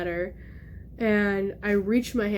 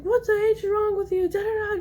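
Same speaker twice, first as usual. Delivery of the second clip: mock a mother's yell, pitched way up; imitated mom yelling; 11 semitones higher than usual. Mom scolding her child after the curtain fell, mock yelling. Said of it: "just, like, yelling at me".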